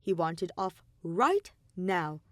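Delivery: usual speaking voice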